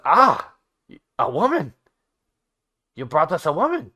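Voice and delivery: a gleeful hammed-up goblin; excited goblin. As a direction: crow it out in delight. Goblin excited about woman.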